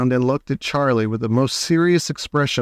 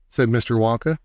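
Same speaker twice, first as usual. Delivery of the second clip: ordinary delivery, nothing marked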